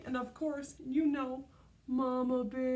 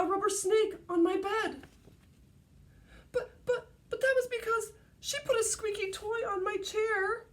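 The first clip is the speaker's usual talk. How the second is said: put-on whiny kid voices; 7 semitones higher than usual. Kids voiced in story.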